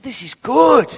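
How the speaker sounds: high, loud exclamation; rousing, heartfelt endorsement; delighted approval. Retold or imitated. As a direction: imitate Barnabas rejoicing at what he sees. Acting out Barnabas rejoicing at believers.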